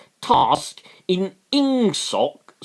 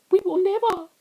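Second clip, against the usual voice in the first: Theresa May impression; much faster than usual. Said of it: "I can't really do a decent May".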